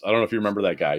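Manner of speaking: usual speaking voice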